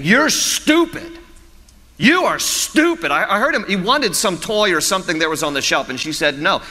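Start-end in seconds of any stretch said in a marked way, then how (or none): 0.0-3.1 high, slow mock kid taunt, drawn out; imitating a yelling child. Kid in the store insulting his mother, high mock child taunt. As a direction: play a bratty little boy sneering at mom. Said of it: "just yelling at his mom".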